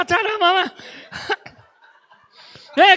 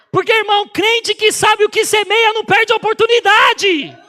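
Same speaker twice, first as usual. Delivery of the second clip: shouted, higher preaching line; preaching at full force; 5 semitones higher, 5 dB louder than usual. Acted. Preacher urging the congregation, shouting. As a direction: proclaim it to the church.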